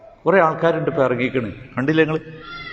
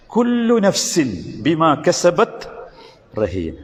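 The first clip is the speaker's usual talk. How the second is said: slow Quran recitation; much slower than usual. Shown as a demonstration. Preacher recites Quran verse.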